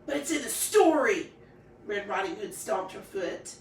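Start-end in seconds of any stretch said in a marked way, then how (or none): 0.1-1.3 stomping petulance. Acted. Girl stomps her foot.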